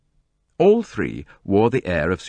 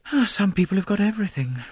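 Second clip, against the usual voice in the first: bitter; 14 semitones higher than usual. A narrator's reading. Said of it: "said Rosalie bitterly".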